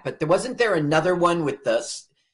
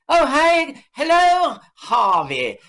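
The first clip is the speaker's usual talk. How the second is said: high, loud, drawn-out caricature; director on the phone; 14 semitones higher, 5 dB louder, slower than usual. Retold or imitated. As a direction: chirp a hammed-up phone greeting far above your own voice. Acting out director phoning Keitel.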